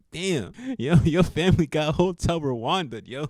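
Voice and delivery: half-laughing shock at the absurd; laughing disbelief. Retold or imitated. Laughing inside at her story.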